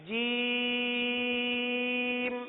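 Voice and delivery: جیم stretched very long and soft; drawn-out jeem. Shown as a demonstration. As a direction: hold جیم for three alifs. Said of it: "تین الف تک کھینچ کے پڑھیں گے". (جیم تین الف تک کھینچ کر)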